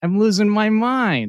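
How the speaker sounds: loud, high cry of frustration; exasperated yelling. Real, not acted. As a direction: blow up, at your wits' end. Venting at lost live shows.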